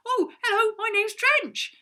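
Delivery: high, loud, beaming greeting; happy hello. Acted. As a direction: greet them with a big bright smile. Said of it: "it did sound like a happy word".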